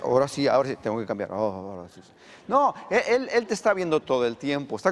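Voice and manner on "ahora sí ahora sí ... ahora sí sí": mock switch to prayer voice. Pastor mocking believers who change their voice, in a low hushed mock-solemn tone. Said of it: "ya sabe tu entonación regular".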